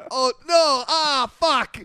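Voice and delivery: hammed-up realisation of a gaffe; replaying the moment he insulted her; mock dismay. Realising his blunder.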